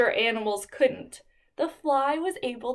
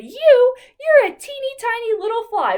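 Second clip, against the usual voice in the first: high, loud, fast scoffing; mocking animals; 11 semitones higher, 8 dB louder than usual. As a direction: laugh him off as tiny. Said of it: "they laughed. They said".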